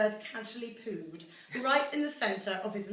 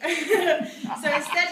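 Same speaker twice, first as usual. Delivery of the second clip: laughing; 6 semitones higher, 8 dB louder, much slower than usual. Real, not acted. Reading poem, laughing mid-line.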